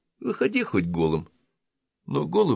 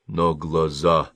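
ordinary delivery, nothing marked

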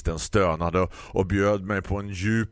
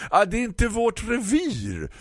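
grumpy florist; 4 dB louder than usual. Acted. Florist lectures the customer about ordering online, high condescending voice.

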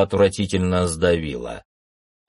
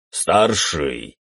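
barking; 4 semitones lower than usual. A narrator's reading. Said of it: "рявкнул он на главного стража".

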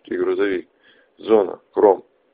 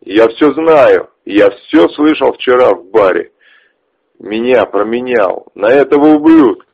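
angry; 3 semitones higher, 8 dB louder than usual. Acted. Ches rages at betrayal.

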